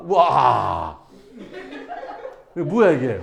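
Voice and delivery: loud slow drawn-out wow; mock amazement. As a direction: fake being blown away. Teacher mock-amazed at problem.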